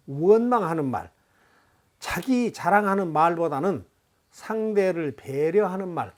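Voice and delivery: usual speaking voice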